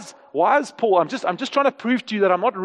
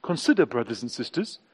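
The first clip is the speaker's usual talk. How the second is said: mild, soft contrast; 8 semitones lower, 6 dB quieter than usual. Retold or imitated. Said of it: "He's not saying".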